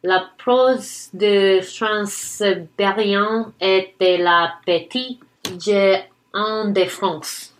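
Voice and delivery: slow, careful French pronunciation; French accent. Real, not acted. Friend reading a French poem title aloud for her co-host, French accent. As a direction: read it out slowly with a French accent. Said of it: "my French accent isn't always there".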